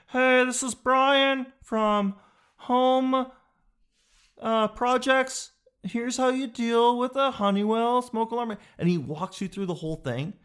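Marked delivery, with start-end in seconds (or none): 0.1-8.6 high, slow, chirpy how-to video host; tutorial guy voice. Retold or imitated. Imitating the YouTube smoke alarm guy, high and slow. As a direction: put on a cheery YouTube helper voice.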